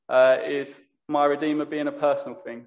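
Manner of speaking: usual speaking voice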